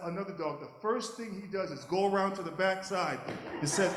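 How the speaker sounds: ordinary delivery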